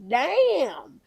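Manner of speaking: impressed reaction. Acted. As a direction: let it burst out in admiration. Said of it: "I was just like".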